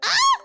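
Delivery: sudden high yelp; a sharp gasping cry of shock; startled cry. Acted. Streamer reading a story, yelping in shock.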